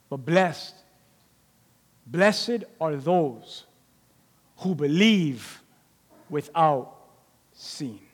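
Jesus blessing unseen believers, slow; slow solemn blessing. Retold or imitated. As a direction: let each word land slowly.

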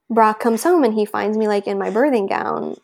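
ordinary talk

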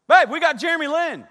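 high, loud gushing wife; over-the-top imitation of an excited wife; mock excitement. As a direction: squeal the news with hammed-up enthusiasm. Acts out wife's forced excited response.

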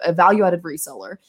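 normal talking voice

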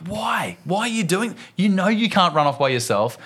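high, exasperated telling-off; angry dad. Retold or imitated. Dad demands why his kid ran off, angry dad mode. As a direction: scold a child who wandered off. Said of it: "I've gone full angry dad mode".

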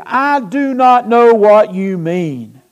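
loud, slow, high denial; drawn-out, forceful acted denial; emphatic denial. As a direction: deny it publicly, one weighty word at a time. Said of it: "he denied it before them all".

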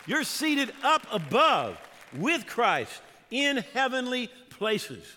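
loud, high, emphatic sermon delivery; full-force preaching. Real, not acted. Preacher telling church they are seated above the devil, full-force preaching. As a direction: preach it with rising force, punching key words.

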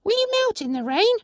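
tiny creature asking in wonder; pixie voice. Acted. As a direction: pixie asking the stranger if rain melts her. Pixie questions the narrator.

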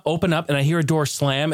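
usual speaking voice

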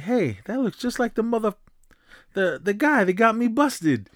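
high, indignant street voice; Billy Ray. Retold or imitated. Billy Ray spotting the man who got him busted, high and indignant. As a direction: pitch up as if spotting the guy who set you up.

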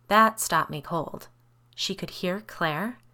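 plain reading voice

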